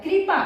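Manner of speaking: कृपा with the i vowel, kripa; English-style pronunciation. Shown as a demonstration. Teacher showing the i sound from the English spelling of कृपा. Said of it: "ई उच्चारण निकल रहा है".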